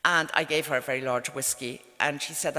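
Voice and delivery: normal talking voice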